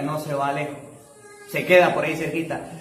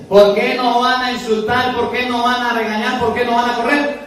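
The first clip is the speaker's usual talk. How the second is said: normal talking voice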